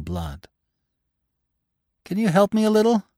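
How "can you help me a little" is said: high, light plea as if unhurt; almost happy. A narrator's reading. Injured hare's wife asks Bambi for help, almost happy. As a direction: ask sweetly in a high voice, untroubled. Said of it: "spoke as if she were well and whole, almost as if she were happy".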